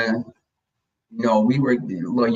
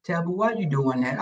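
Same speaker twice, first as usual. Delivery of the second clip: soft mock-mild telling-off; quietly ineffectual pet owner; ex with no bass; 7 dB quieter than usual. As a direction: imitate a feeble, gentle scolding. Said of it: "gotta put bass in your voice".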